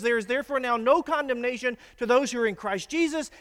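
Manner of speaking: loud, high proclamation. Shown as a demonstration. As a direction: declare the verse boldly at full voice. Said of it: "You say that out loud".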